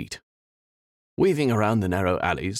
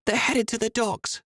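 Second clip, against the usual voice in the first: crowing. A narrator's reading. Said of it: "Esme crowed".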